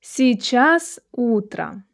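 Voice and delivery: slow, drawn-out phrase for learners to repeat; measured language-teacher demonstration; slow model reading. Shown as a demonstration. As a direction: read it slowly, syllable by syllable. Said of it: "Try to focus on my articulation".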